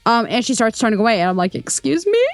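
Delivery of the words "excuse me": high rising, puzzled question; retold bewildered query; confused. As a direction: ask it baffled, pitch climbing at the end. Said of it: "I was a little confused".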